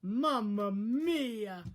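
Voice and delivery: slow, high Italian exclamation; Mario's dad. Retold or imitated. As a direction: stretch it out like a cartoon Italian dad. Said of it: "hearing Mario's dad say".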